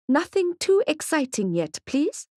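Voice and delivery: high character voice. Acted. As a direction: pitch it up for the character.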